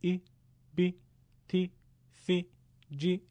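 "ای، بی، تی، سی، جی" with short, clear i vowels; each syllable short and separate, vowel leaning to ee; Arabic-style short i on each letter; Arabic kasra. Shown as a demonstration.

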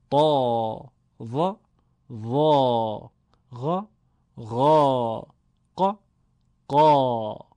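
each heavy letter with a fat, full Arabic a. Shown as a demonstration. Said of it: "درشت و پرحجم".

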